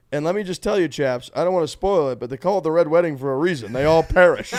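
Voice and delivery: high, loud British broadcaster voice; Nick Faldo. Retold or imitated. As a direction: ham up a posh British commentator. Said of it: "This guy goes".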